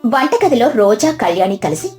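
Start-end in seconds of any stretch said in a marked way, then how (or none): none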